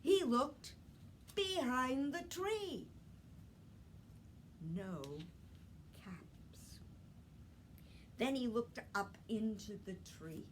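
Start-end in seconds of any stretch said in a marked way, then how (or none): 4.7-6.8 whispered suspense. A narrator's reading. Storyteller whispers suspense beat.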